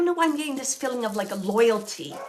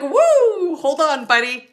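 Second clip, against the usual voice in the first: mock swearing outburst; 5 semitones higher, 9 dB louder, slower than usual. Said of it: "It's like".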